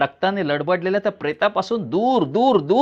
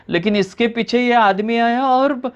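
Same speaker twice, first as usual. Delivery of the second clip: higher, tearful breaking voice; sobbing; 6 semitones higher than usual. A narrator's reading. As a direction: sob out the accusation. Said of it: "हुंदके देत ती तरुणी सांगू लागते". (हुंदके देत पोलिसांना सांगणारी तरुणी)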